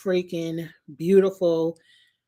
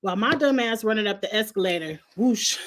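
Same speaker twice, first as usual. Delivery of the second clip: normal talking voice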